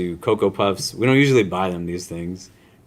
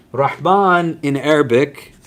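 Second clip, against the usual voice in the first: Rahman said higher, louder and slower; stressed Rahman; 5 semitones higher, 4 dB louder than usual. Shown as a demonstration. Teacher introducing the divine name, slow emphatic Rahman. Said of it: "is something that denotes".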